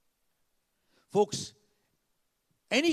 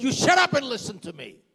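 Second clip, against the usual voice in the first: retold angry shout; 8 semitones higher, 12 dB louder than usual. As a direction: shout it at full force, high and loud. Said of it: "He shouted at me".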